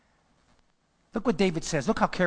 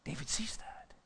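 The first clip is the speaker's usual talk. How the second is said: soft unvoiced whisper; 4 semitones lower, 16 dB quieter than usual. Real, not acted. Preacher whispers an aside to the congregation. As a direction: drop to a whisper.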